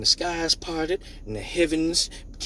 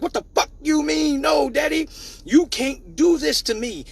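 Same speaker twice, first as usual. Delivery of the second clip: put-on inner kid outburst; 11 semitones higher than usual. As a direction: scream it inside like a hurt child, slowing at the end. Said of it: "But in my head".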